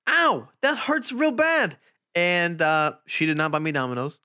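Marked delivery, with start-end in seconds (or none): 0.1-1.8 much higher, slow hammed-up whimper; mock whine. Boyfriend replays his own complaint, high slow mock whine. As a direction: drag it out like a wounded child. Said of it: "I was like".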